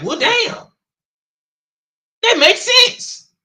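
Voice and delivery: high, slow and loud, "well, damn, that makes sense"; surprised realization. Retold or imitated. Me reacting to Katz's answer, in mock high surprise. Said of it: "I was like".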